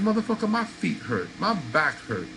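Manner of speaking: ordinary talk, nothing marked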